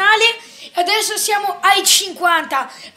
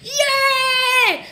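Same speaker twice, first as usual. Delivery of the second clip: high joyful yeah shout; cheering yell; 8 semitones higher than usual. Real, not acted. Celebrating fifty subscribers.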